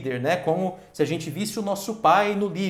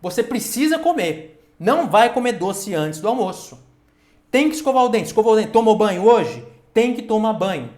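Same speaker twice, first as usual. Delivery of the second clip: louder, higher bossy parent orders; commanding parent, no arguing; strict parent; 3 semitones higher, 7 dB louder than usual. Retold or imitated. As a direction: bark the chores like a firm dad. Strict parent voiced, contrasted.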